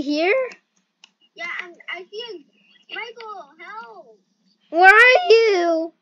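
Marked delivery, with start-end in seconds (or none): 4.7-5.9 yelling across the room. Real, not acted. Calling out to a lost teammate.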